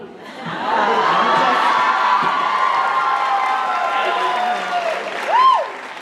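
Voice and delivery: high shrieking burst of laughter. Real, not acted. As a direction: let out a big shrieking laugh. Big laugh at own accent joke.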